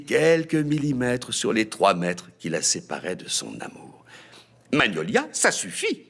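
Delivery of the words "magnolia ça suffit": mother imposing. A narrator's reading. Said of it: "tenta d'imposer Azalée".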